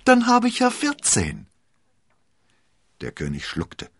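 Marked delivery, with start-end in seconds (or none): 0.0-1.5 very high, loud queenly surprise; feigned astonishment. A narrator's reading. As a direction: exclaim in a high voice, pretending to be amazed. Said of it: "tat sehr erstaunt".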